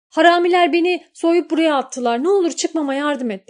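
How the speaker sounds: high, loud call for help; pleading shout. Acted. Young man calls to the girl from the well, high loud plea. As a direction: shout the lie upward, begging.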